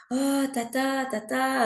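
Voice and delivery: put-on high, childlike call of tata; calling his aunt like an eager little boy; nephew calling aunt. Nephew runs up from the garden, calling his aunt in a high childlike voice. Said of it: "qui me dit".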